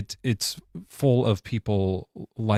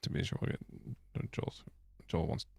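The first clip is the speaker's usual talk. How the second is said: whisper; 4 semitones lower, 15 dB quieter than usual. Real, not acted. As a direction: mutter it under your breath. Aside while checking chat.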